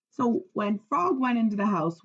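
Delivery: plain reading voice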